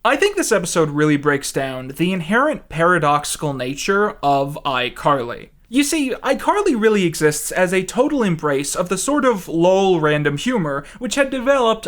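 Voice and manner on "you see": ordinary talk, nothing marked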